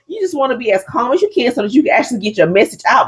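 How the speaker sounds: usual speaking voice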